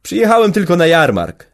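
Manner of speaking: schoolboy son cheerfully calling out to Boryna. A narrator's reading. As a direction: call it out brightly like a happy boy. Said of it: "zawołał wesoło organiściuch".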